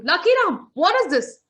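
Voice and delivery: high, loud startled outburst; shocked and stuck. Acted. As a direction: react as if glued to the chair. Yama stuck, calls to Lucky Ram.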